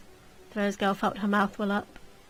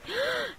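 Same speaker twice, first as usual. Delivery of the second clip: sharp gasp; 8 dB quieter than usual. Acted. Ladies shocked.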